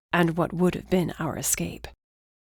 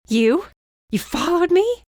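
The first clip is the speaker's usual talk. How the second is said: high, loud outraged yell; 12 semitones higher, 6 dB louder than usual. Acted. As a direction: shout at him in disbelief. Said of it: "she yelled".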